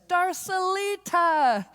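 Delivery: high, loud, drawn-out call of a name; joyful call. Retold or imitated. Friend running in with open arms, calling out in a high joyful cry. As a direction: call her name across the room with joy. Ginny calls out running to her.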